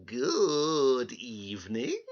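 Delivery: slow, odd little man voice; strange little man. Acted. Little man greets the crying girl, slow and odd. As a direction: greet her slyly and slowly. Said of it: "he said".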